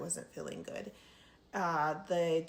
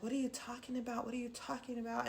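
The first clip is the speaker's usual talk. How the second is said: higher, softer, fast fake innocence; babying, fake caring; 5 semitones higher, 7 dB quieter, much faster than usual. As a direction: coo it like soothing a child, pretending to know nothing. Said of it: "trying to baby me".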